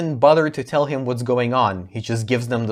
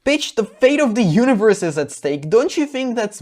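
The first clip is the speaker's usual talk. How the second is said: high, louder scolding; exasperated scolding; 8 semitones higher, 3 dB louder than usual. Real, not acted. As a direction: scold her, fed up. Scolding the character.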